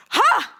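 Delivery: loud, high evil cackle; evil villain laugh. Shown as a demonstration. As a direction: throw your head back and cackle. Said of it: "my villain laugh will be, get ready".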